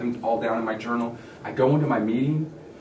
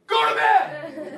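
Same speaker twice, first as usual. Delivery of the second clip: very high, drawn-out mock scream; hammed-up exhausted dad yelling; much slower than usual. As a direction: holler it slowly like a frazzled parent. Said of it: "I yell".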